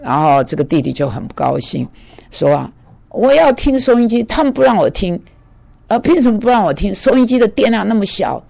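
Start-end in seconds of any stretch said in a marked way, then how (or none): 3.1-8.4 upset patient. Retold or imitated. Angry patient demands his radio.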